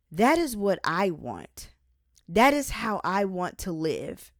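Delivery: ordinary delivery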